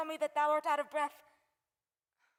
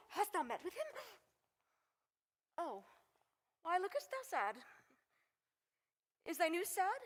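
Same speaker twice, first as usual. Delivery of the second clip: ordinary talk, nothing marked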